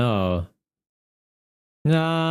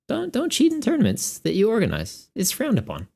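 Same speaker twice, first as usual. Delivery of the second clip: normal talking voice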